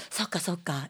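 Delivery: normal talking voice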